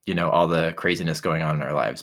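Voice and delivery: ordinary delivery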